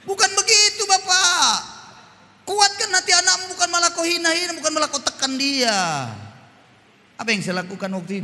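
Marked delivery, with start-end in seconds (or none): 0.0-6.1 rebuking fathers. Real, not acted.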